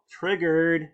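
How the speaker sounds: sarcastic mock outrage. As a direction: sneer the word like a taunt. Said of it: "mocking triggered people".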